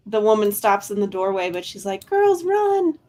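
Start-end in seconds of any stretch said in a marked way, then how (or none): 2.1-3.0 high-pitched urgent call; panicked cry. Acted. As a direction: call out like a frightened woman. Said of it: "and she screams".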